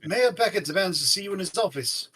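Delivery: loud, fast urgent message, Now barked; relayed yell. Acted. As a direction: shout the mayor's demand down the line. Murdoch relaying yelling mayor.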